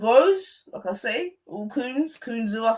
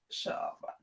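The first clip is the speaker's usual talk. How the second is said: breathy unvoiced hush at a friend; whispered; 17 dB quieter than usual. Real, not acted. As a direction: whisper it under your breath. Laughing, telling friend shut up.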